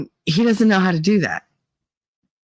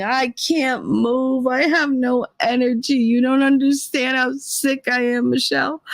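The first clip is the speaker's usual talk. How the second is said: mother's dying complaint; 6 semitones higher than usual. Retold or imitated. Daughter imitating her sick mother complaining to her, melodramatic and high. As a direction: wail it in mock agony, stretching words. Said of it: "She's telling me she's dying".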